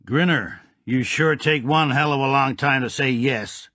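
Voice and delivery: sour gangster. A narrator's reading. Mister Midnight needling Grinner, mildly sour. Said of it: "commented Mister Midnight sourly".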